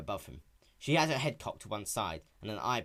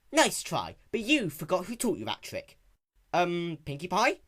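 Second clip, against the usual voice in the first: cartoon character voices; 7 semitones higher, 5 dB louder than usual. Dialogue voiced in story.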